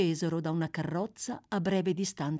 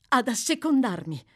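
higher and louder, a stung echo of his word; indignant exclamation; 7 semitones higher, 4 dB louder than usual. A narrator's reading. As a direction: throw "Ad assecondarmi?" back at him, offended. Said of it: "esclamò la voce della ragazza".

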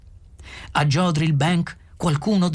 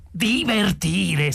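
drawn-out, higher-pitched disbelief; incredulous shriek; 6 semitones higher, much slower than usual. A narrator's reading. Arthur echoes Ford's advice, incredulous slow shriek. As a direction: repeat it slowly, aghast, stretching the vowels. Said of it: "strillò Arthur".

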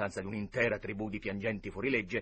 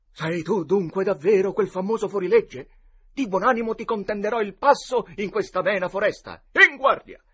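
put-on loud, high boyish declaiming; mock-heroic duellist; 10 semitones higher, 11 dB louder than usual. A narrator's reading. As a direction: proclaim the challenge like a storybook outlaw. Boys declaim before a duel. (eroe spavaldo che sfida a duello)

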